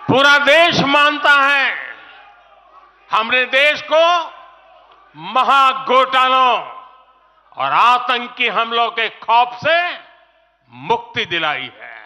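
rally shout. Real, not acted. Leader rousing the rally crowd, full shout. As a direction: build it into a full-voiced roar. Rally speech, rhetorical climax.

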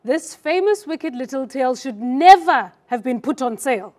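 slow, loud recital; a first take of the poem line, read slow and loud. Shown as a demonstration. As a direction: read it out slowly and loudly. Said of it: "I'll read the first line".

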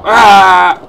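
loud high yell; startled scream. Real, not acted. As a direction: scream as the game catches you out.

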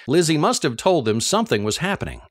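ordinary delivery

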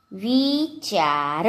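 વિચાર drawn out, rising at the end; slow word demonstration. Shown as a demonstration. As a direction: say વિચાર very slowly by syllables. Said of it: "જોડણી પર ધ્યાન આપજો".

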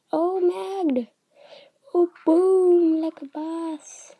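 loud, high, drawn-out exclamation; excited gamer boast; triumphant. Real, not acted. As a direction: crow over a winning hit. Triumphant hit in the game.

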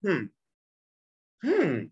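doctor's thoughtful "hmm", imitated; acted musing hum, the second swooping up; pondering hum. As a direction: hum as if studying the notes. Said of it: "And he's like".

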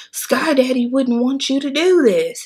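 sing-song mockery of a sign-waving protester; mock preachy protester. Retelling the protesters outside the clinic, mock preachy voice.

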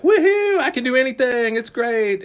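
high, loud whooping invincible youth; excited young kid. Retold or imitated. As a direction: whoop it up like a kid who thinks he can do anything. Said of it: "You were like".

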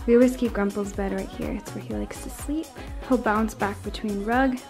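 ordinary talk, nothing marked

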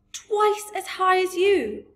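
high, loud, drawn-out disbelief; astonished cry. A narrator's reading. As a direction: exclaim slowly as an amazed child. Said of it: "cried Sophie".

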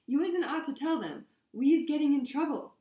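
high-pitched, loud, distraught pleading; sobbing elf. A narrator's reading. Winky warns her master not to tell them, sobbing. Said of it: "sobbed Winky through her hands".

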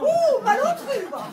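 very high, loud outraged cry; indignant shriek. Acted. Offended neighbour insulting the lion's owner, shrieking with outrage.

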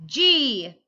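sudden loud letter call. Acted. Calling out letters in game.

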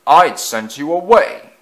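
forceful reprimand from the boss; severe. A narrator's reading. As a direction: dismiss him severely, voice lifted. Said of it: "spoke to him severely".

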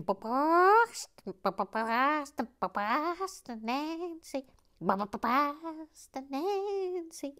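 high, very slow, hammed-up Boston "Boston Nancy"; Boston accent. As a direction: drag out a bad Boston accent. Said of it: "I portray a".

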